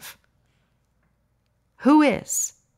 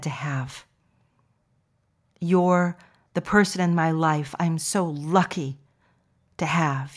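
gentle affirmations; 4 semitones lower than usual. Acted. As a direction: pour kindness on each listener. Soothing affirmations to listeners.

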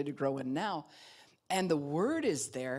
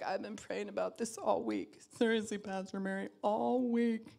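higher, pleading churchgoer voice; whiny congregant; 5 semitones higher than usual. Retold or imitated. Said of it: "you're like".

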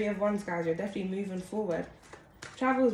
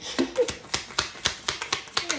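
high, airy laugh at oneself; helpless laugh after losing the thread; breathy burst of high laughter; 9 semitones higher than usual. Real, not acted. Lost her thread, laughs at herself.

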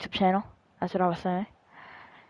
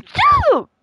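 sudden high, loud yell; a high, loud shout of shut up; 8 dB louder than usual. Real, not acted. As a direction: explode at your friend over the game. Trash talk at friend in game.

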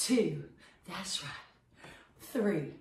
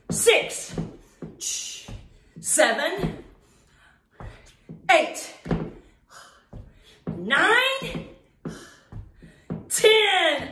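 excited count; 8 semitones higher, much slower than usual. Acted. Instructor cheering the class through the final reps, high excited count. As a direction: cheer the last reps home.

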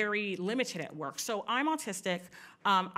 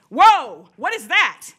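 loud, high, alarmed demand; startled officer's challenge; 5 semitones higher, 16 dB louder, much slower than usual. Retold or imitated. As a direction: mimic a cop barking out in alarm. Acting out startled officer confronting her.

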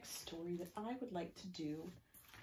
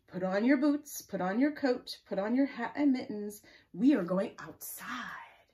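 loud, rhythmic picture-book reading; story read-aloud; 4 semitones higher, 11 dB louder than usual. Acted. Reading a snow-day story to children, lively and loud.

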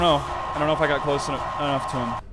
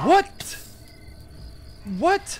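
shocked; 3 dB louder than usual. Real, not acted. Sudden mobs appear.

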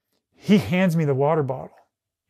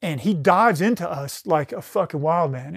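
normal talking voice

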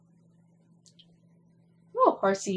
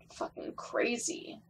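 whisper; 8 dB quieter than usual. Real, not acted. Muttering during gameplay.

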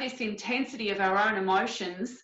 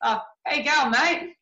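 broad Australian, pitched higher and louder; cheery Australian mate greeting, higher and louder; Aussie accent; 5 semitones higher, 4 dB louder than usual. Retold or imitated. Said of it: "I'll give you the best Aussie accent that I've got".

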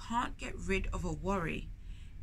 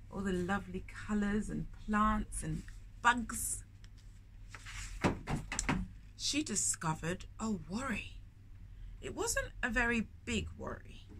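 put-on storyteller reading to children; story narration. A narrator's reading. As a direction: read it like a picture book to little ones. Reads the picture book aloud.

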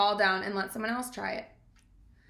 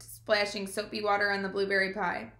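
ordinary delivery

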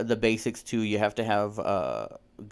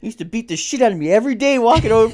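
much higher, much louder accusing shout; angry yell; 11 semitones higher, 10 dB louder than usual. Retold or imitated. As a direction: throw it at the bully who beat him up. Retold confrontation with old bully.

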